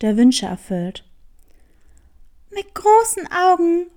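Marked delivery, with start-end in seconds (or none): 0.0-1.1 "Wünsche" stressed, low and settled, falling to the end; the cat says "der Wünsche erfüllt" as plain fact, low; low cat statement. Acted. Cat states it, hare repeats. (tiefer Kater, "Wünsche" betont, fallend)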